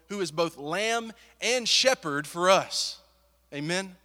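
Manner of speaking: rally the room toward the amen; preaching. Acted. Preacher rallying the congregation, building fervent preaching.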